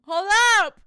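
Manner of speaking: sudden loud call to stop; loud hold up. Real, not acted. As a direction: shout to stop everything. Suddenly spots something on her card.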